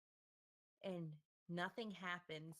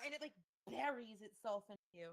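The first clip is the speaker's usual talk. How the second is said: usual speaking voice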